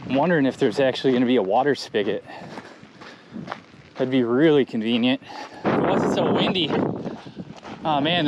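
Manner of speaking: normal talking voice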